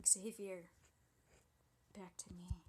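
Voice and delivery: ordinary delivery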